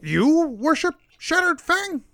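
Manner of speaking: high-pitched kobold voice, swooping up; little kobold, pitched well up; questioning kobold. Acted. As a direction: question it as a small high creature.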